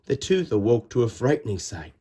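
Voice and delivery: ordinary delivery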